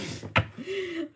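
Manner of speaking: high, soft laugh mid-story. Real, not acted. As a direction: crack up while telling it. Cracks up telling the story.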